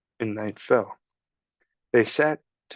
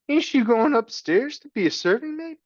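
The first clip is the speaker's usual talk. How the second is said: put-on high childish voice, much higher; a scrappy kid asking hopefully; rough little girl; 12 semitones higher than usual. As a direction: Meg asking the rat about her future. Meg's voice.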